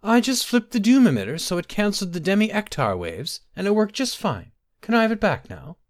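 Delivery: high-pitched maid pleading her case; nervous. A narrator's reading. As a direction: keep it high and anxious to please. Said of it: "wringing her hands nervously".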